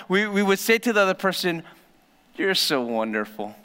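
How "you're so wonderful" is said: low, softer mock praise; teasing sarcasm. Couple teasing each other over something dorky, low mock praise. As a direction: tease your partner gently. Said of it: "doing something lame or dorky".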